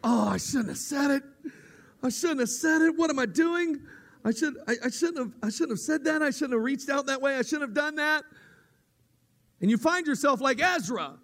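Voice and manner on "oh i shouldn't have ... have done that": higher, louder panicky self-reproach; hammed-up fretting, spiralling higher; mock panic. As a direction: act out the regret after speaking up. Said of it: "afterwards you're going".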